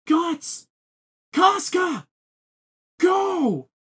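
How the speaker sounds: high-pitched imitated cries; urgent calling out. Voicing a man urging friends to flee the eclipse, urgent calling out. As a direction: call out names in a high, strained voice. Voicing Judo shouting during the panic.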